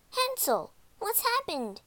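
put-on high, worried girl's call. A narrator's reading. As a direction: call to your brother, pitched high. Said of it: "called Gretel".

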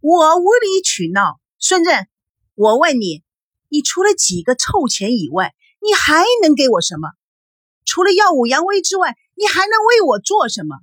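wife escalating a heated row. A narrator's reading. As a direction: hurl accusing questions, voice climbing. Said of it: "叶枫的音调又提高了三格".

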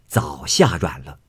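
plain reading voice

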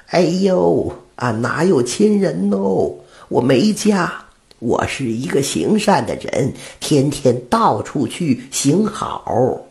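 put-on high, slow old woman's voice. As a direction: play the old beggar woman kindly. Old woman answers the butcher. (老太太的声音，高而慢)

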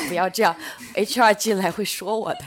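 laughing while pleading; laughing protest. Real, not acted.

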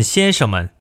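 ordinary talk, nothing marked

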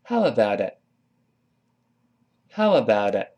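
"How about it" joined into one word; repeat-after-me "How about it", t softened towards d; smoothly linked "How about it"; linked model reading. Shown as a demonstration. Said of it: "跟老师读一下".

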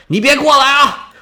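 sharp high yell at an intruder; sentry yelling at the old man to stay back; guard's shout. Acted. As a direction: stop him cold with a high shout.

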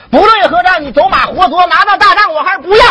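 high, rising stage patter; high-pitched theatrical recital; stage declamation. Acted. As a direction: declaim it high to the other player. Acted stage boast in dialogue.

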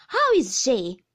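loud, sharp repeated question; impatient demand. A narrator's reading. Aunt asking after the baby, impatient. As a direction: press the question loudly.

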